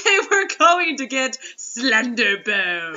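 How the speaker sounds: slow, laughing shout of the name. Real, not acted. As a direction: cracks up mid-line, loud. Laughing spooky pronouncement.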